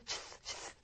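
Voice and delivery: 쉬 repeated as short voiceless hisses; unvoiced sibilants. Shown as a demonstration. Teacher showing awkward similar sounds in a row, 쉬 hissed without voice. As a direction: push out each 쉬 as pure air. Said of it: "이렇게 안 울리니까".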